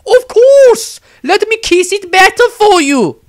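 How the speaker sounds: high, loud, syrupy nun voice; zealous nun. A narrator's reading. Nun Angelique sweetly insists to the wizard, high and loud. Nun offers to kiss hand.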